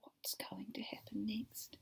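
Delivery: slow unvoiced whisper. Real, not acted. Teacher asking the class, whispering with suspense. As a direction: build suspense in a hush.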